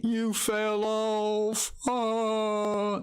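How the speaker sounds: high, slow, whiny mockery of a troll; hammed-up high voice of a sore loser; mocking the hater. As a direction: sneer his words back at him in a silly high voice. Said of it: "He came in Twitch. He".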